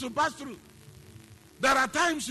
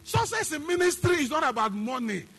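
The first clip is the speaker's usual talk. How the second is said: fiery preaching; 4 semitones higher, 7 dB louder than usual. Acted. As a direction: hammer the point home to the congregation. Preaching at full force.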